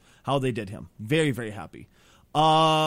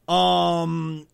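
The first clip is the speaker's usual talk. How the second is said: usual speaking voice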